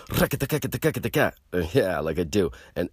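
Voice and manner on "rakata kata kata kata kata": high, fast rakata-kata slap-bass noise with the mouth; mouthed slap bass riff. Retold or imitated. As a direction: rattle off the slapping like a bass line. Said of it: "and I went".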